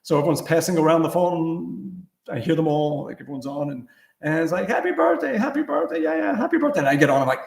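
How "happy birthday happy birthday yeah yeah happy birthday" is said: much higher, singsong birthday wishes; chirpy family greetings. Retold or imitated. Relatives on the phone, high singsong birthday wishes. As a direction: mimic relatives cooing happy birthday.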